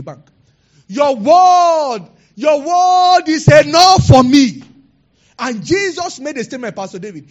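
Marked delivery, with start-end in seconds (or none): 0.9-4.6 booming sermon cry, drawn out; preaching at full force. Real, not acted. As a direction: proclaim it to the whole church.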